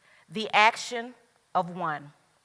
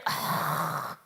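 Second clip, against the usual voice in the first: mock groan; 8 dB quieter than usual. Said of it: "some of you may be saying".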